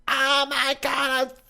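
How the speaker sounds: high, slow, drawn-out fake apology; mock apologetic server. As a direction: play the sorry server, stretching each word.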